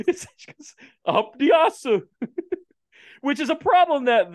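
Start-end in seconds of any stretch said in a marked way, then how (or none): 1.1-1.9 high, loud Japanese-accented imitation; exaggerated Japanese accent. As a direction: mimic a Japanese voice actress, pitched way up. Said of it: "said by the Japanese woman".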